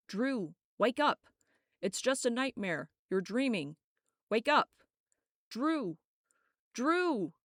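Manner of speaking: shouting to wake a dreamer; urgent shouting. Acted. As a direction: shout her name to break the nightmare. Shaking her awake from nightmare.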